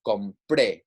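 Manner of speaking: "compre" with the final "pre" stressed; drawn-out "compre", landing hard on "pre"; stress on last syllable. Shown as a demonstration. Teacher showing the preterite accent, "compre" stressed on its final syllable. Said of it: "putting that stress on the last syllable".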